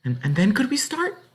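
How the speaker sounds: put-on high, hopeful girl; eager to leave at once; high stammering girl; asking. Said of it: "asked Ellen".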